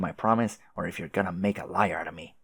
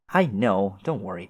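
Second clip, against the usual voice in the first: ordinary delivery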